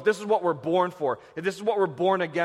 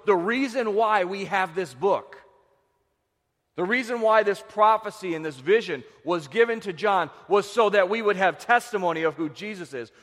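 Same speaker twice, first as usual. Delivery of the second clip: ordinary talk, nothing marked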